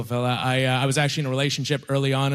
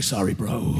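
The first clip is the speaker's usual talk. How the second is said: low, dude-like apology; bro-style apology; 7 semitones lower, slower than usual. Retold or imitated. Boyfriend apologizing to his girlfriend, low and bro-like.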